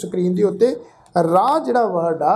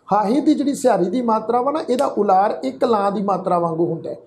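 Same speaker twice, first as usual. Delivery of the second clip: ordinary talk, nothing marked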